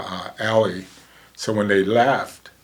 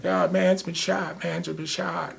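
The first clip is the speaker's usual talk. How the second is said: higher, slow, insistent repeat; urgent report; 8 semitones higher, 4 dB quieter than usual. Retold or imitated. Strangers telling the paramedic a man is shot, urgent and high. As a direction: press the news on him, pitched up.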